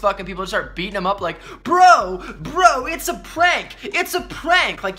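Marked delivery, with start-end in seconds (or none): 1.6-4.8 much higher, louder, slow desperate bro; mock frantic prankster plea; panicked pleading. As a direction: beg them to stop, it's a joke. Pranksters pleading while beaten.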